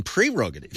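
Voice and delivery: "prerogative" with a clear PRE at the start; corrected prerogative. Shown as a demonstration. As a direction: sound out pree-rogative in "prerogative". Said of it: "it's actually prerogative".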